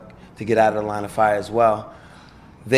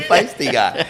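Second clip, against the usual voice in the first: laughing quote; 5 semitones higher, 5 dB louder, slower than usual. Retold or imitated. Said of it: "He said".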